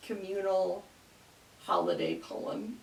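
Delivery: normal talking voice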